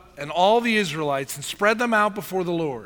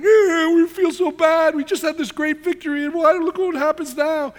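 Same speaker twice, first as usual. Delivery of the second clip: high-pitched hammed-up whine, loud at first; mock crybaby snivelling; whining; 10 semitones higher, 5 dB louder than usual. Preacher mocks Joshua crying to God after defeat, whining. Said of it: "Then Joshua starts whining".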